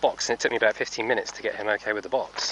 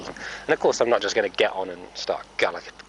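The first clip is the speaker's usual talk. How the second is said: usual speaking voice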